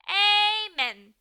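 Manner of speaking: a loud, high, eager amen. Acted. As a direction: belt out the amen. Loud amen after the prayer.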